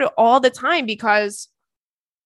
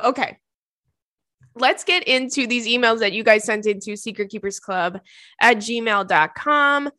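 normal talking voice

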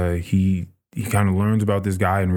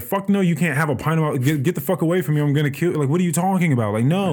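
much higher, indignant refusal; outraged pilot; 7 semitones higher, faster than usual. Retold or imitated. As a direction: recoil from the vampire, pitched way up. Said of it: "He's like".

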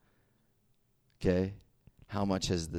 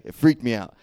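suddenly loud, higher exclamation; loud emphatic; 4 semitones higher, 10 dB louder than usual. Real, not acted. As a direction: burst out with it. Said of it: "That last part".

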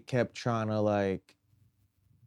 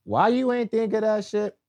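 high, indignant outburst; outraged; 9 semitones higher than usual. Real, not acted. Outraged rhetorical question.

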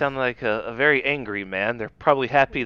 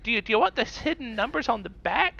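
high, eager, oblivious voice; naive old lady; 11 semitones higher than usual. Acted. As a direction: chirp it like a trusting grandma. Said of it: "someone that'll be like".

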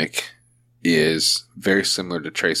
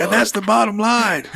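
gruff wrestler promo voice; Stone Cold Steve Austin impression; 13 semitones higher than usual. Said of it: "I'm also got Stone Cold Steve Austin".

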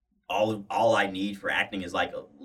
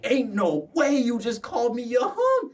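high, loud outraged shout; mock outrage; 4 dB louder than usual. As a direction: play up the disbelief. Retold outraged reply.

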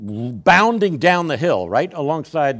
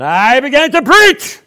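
high, booming open-air holler; slow, rising street-preacher shout; hollered preaching; 12 semitones higher, 13 dB louder, slower than usual. Acted. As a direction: draw it out loud to carry across a river. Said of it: "holler and preach to a lot of people".

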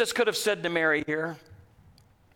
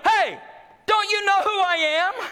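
mock demanding Jesus; 9 semitones higher than usual. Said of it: "He doesn't go".

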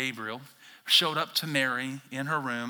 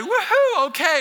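mock cheer; 8 dB louder than usual. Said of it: "you're probably not gonna, like".

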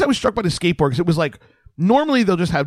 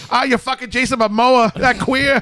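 put-on Boston accent; 9 semitones higher than usual. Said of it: "He's like".

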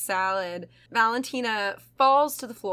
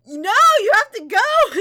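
mock demand; 10 semitones higher, 7 dB louder than usual. Acted roommate ordering her out.

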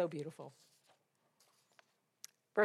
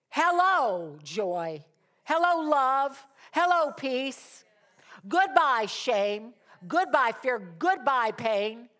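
high, loud hello, dropping on the next word; calling out greetings; 6 semitones higher, 8 dB louder, much slower than usual. Acted. Preacher greeting joy and sending off shame, exultant calling out. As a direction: hail and dismiss each one emphatically.

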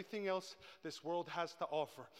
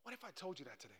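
soft, intimate appeal to the congregation; hushed preaching; 9 dB quieter than usual. Real, not acted. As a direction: bring the sermon down very quiet.

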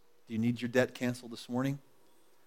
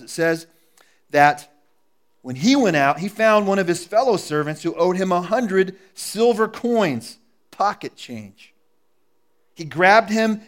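loud, higher preaching storytelling; preaching at full force; 5 semitones higher, 10 dB louder than usual. Acted. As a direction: drive the story home from the pulpit.

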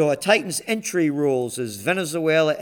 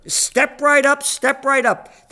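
high, loud, slow barker call; carnival barker; 9 semitones higher, 5 dB louder, slower than usual. Acted.

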